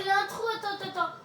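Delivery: excited urgent calling. Real, not acted. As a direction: stop them urgently. Startled, stopping partner.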